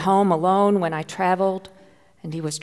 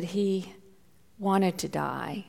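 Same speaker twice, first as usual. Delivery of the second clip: soft and slow, fading into a whisper; barely able to say it aloud; real hushed grief; 7 dB quieter than usual. Real, not acted. Telling of son's suicidal plan.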